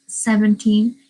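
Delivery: ordinary talk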